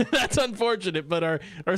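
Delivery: laughing it out; chuckling the words through. Real, not acted. Repeats it laughing.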